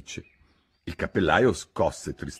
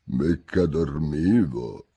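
slow, low, sleepy croak; hoarse little just-woken voice; tiny hoarse voice; 4 semitones lower, much slower than usual. A narrator's reading. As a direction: croak it drowsily as the Dormouse. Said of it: "con una vocina roca roca".

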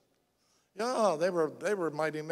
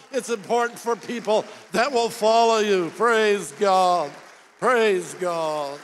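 louder, fervent preaching; 3 semitones higher, 5 dB louder than usual. Real, not acted. Preacher urging the church back into the race, fervent. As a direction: rouse the congregation to act.

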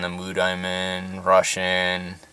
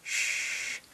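breathy whispered shush; Elmer Fudd shush; 13 dB quieter than usual. Pretend. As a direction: hush it like a hunter sneaking up. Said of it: "yeah, that, Elmer Fudd".

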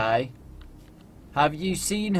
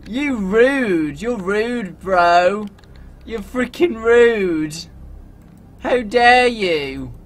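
mock-outraged, drawn out; indignant scolding; 5 semitones higher, 6 dB louder, much slower than usual. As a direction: tell off the rude driver. Scolding driver who ran over.

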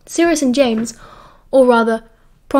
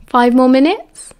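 slow, cheeky question with a lifted ending; 4 dB louder, slower than usual. A narrator's reading. Sirius pushing his luck with Lily, cheeky. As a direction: ask it cheekily, drawing out the rise. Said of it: "Sirius asked cheekily".

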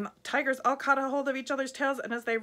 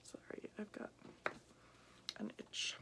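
soft unvoiced whisper; whispered apology; 18 dB quieter than usual. Real, not acted. Reader apologising to the kids in a whisper. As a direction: murmur it aside. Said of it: "whispered apology".

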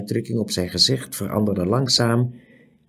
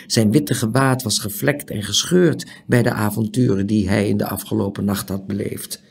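ordinary delivery

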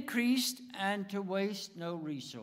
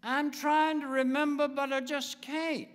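put-on embarrassed old man; 6 semitones higher than usual. Joke character confessing.

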